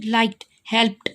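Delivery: लाइक्ड, हेल्प्ड both ending in a t sound; final d of लाइक्ड and हेल्प्ड turned to ट. Shown as a demonstration. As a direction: say लाइक्ड, हेल्प्ड as "likt, helpt". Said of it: "ट sound है पीछे".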